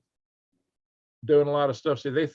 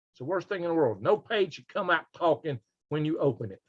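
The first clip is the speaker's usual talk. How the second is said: usual speaking voice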